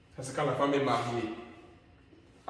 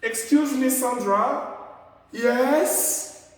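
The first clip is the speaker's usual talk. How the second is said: polite call by name; 8 semitones higher, 4 dB louder, much slower than usual. Shown as a demonstration. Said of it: "c'est beau comme ça".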